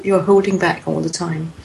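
usual speaking voice

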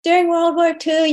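the mom insisting she remembers; mother recalling wartime chanting, voiced high; imitating mother.

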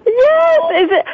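a real, high, happy cry of recognition; overjoyed high-pitched yes; delighted squeal. Real, not acted. Surprise reunion on live call.

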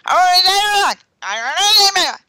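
high, loud mock scream; high-pitched scream of a distressed worker; Charlie screaming. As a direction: belt it like a man losing his mind. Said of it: "He's going something like".

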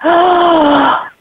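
sharp gasp then a stunned no; a real shocked gasp, then no. Real, not acted. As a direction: gasp in disbelief at the story. Reacting to shocking story.

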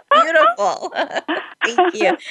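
high delighted laugh and thanks. Real, not acted. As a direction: gush through laughter. Host delighted by guest's answer.

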